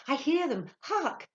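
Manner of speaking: high, loud exclamation; eager, lifted cry; excited cry. A narrator's reading. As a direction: call out in sudden excitement. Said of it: "she cried, and again leapt".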